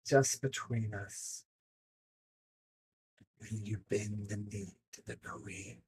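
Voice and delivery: hushed, distant mystic whisper; slow secretive whisper from nowhere; whispering voice in the head. Acted.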